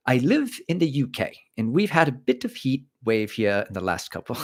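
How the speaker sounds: badly faked British accent, lilting pitch swings; hammed-up attempt at a British accent; mock British accent. Streamer rereading a UK viewer's comment, mock British accent. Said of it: "talk with a British accent".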